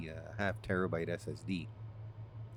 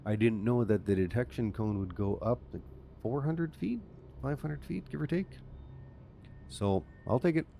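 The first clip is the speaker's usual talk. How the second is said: usual speaking voice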